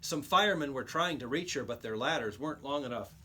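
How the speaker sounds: ordinary delivery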